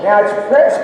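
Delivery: ordinary talk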